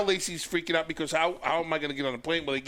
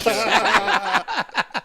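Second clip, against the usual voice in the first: mock yell; 7 semitones higher, 8 dB louder than usual. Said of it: "Chavo yells at the guy".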